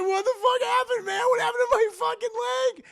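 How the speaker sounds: high, loud wailing panic; imitating a screaming victim; hysterical horror. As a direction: cry it out in a frantic shout. Justin hysterical over his missing leg.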